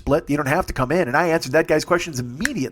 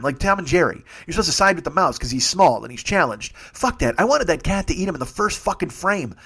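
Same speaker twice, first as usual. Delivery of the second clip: ordinary talk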